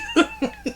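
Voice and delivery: squealing laugh breaking through; high burst of laughter. Real, not acted. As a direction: let a high laugh escape. Cracking up at the joke.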